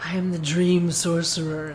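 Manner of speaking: low, hushed mock-villain announcement; lightly voiced self-important dream villain. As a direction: drop low for a pompous title. Mocking the self-important villain's title.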